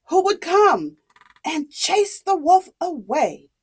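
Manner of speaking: ordinary delivery, nothing marked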